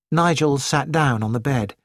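plain reading voice

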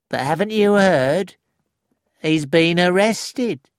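a lightly voiced old woman, the last words slow; old housekeeper breaking the news, slowing down; lightly voiced old woman, slow at the end. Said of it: "opened by an old woman".

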